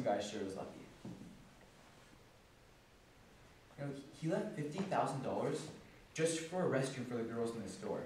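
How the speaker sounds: very soft, low and subdued; wistful musing. Acted. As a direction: think aloud with quiet envy. Wistful thoughts on dead boss's life.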